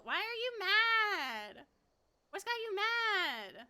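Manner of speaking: high, slow cooing voice; baby talk. Acted. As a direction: coo at him like a pet. Cooing at angry character.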